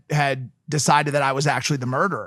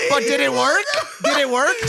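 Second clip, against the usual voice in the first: excited teasing; 10 semitones higher than usual. Real, not acted. Needling friend about the joke, high and eager. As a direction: press him gleefully.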